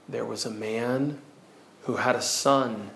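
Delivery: storytelling that grows steadily from hushed to impassioned; gradual crescendo. Shown as a demonstration. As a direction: let pitch and volume climb in proportion. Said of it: "a slow increase, a growth".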